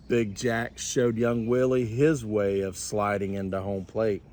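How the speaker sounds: usual speaking voice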